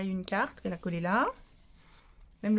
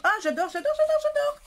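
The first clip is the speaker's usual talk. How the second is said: much higher, louder gushing squeals; overjoyed squeal; 6 dB louder than usual. Real, not acted. Vlogger opening a friend's gift, squealing with delight. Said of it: "je perds mon vocabulaire quand, euh, je suis tout émue".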